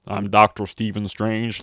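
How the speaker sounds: slow, loud mock American accent; Benedict Cumberbatch as Doctor Strange. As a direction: announce it slowly in a generic American accent. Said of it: "the accent Benedict Cumberbatch does in Doctor Strange".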